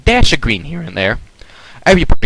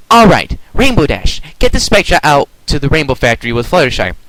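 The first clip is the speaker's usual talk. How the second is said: a higher storybook heroine voice directing her friends; Twilight giving orders; 5 semitones higher, 4 dB louder than usual. Acted. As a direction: take charge of the team, deliberately.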